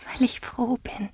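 high, very soft whisper; light, delicate empress voice; gently happy. A narrator's reading. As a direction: breathe it with quiet gladness. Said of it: "singende Lachen".

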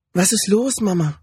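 put-on high-pitched little boy asking his mother; child's questioning voice, lifted high; small child's voice. A narrator's reading. Little son asks his mother.

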